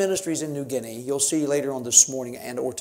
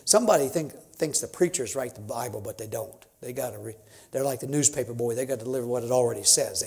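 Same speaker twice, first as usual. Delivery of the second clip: ordinary delivery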